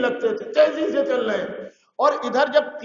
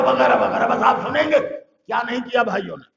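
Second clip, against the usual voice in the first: normal talking voice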